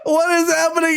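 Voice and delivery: high laugh, then laughing disbelief; half-crying with laughter. Real, not acted. Laughing at AI output.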